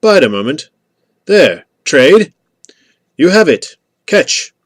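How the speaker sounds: high, loud calls across a distance; calling out. A narrator's reading. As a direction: call out short replies to someone down the hill. Traders calling across a dark hillside.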